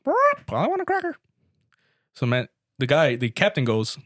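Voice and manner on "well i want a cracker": squawky parrot, much higher and fast. Acted. Hidden parrot butting in on the captain, in a high fast parrot voice. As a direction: chirp it out like a talking parrot. Parrot speaks again in joke.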